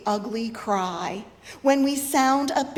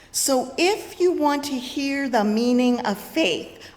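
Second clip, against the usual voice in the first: normal talking voice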